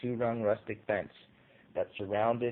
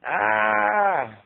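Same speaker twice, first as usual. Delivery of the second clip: high, loud frightened scream; 10 semitones higher, 8 dB louder than usual. A narrator's reading. Frightened lady pointing at the grass, scream. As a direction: shriek at the sight.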